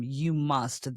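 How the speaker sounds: ordinary talk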